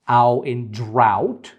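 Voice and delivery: "ow" and "drought" said slowly, the glide from "a" to "u" drawn out; slow example word. Shown as a demonstration. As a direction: model "ow in drought" slowly for the class. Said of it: "So ow is like cow".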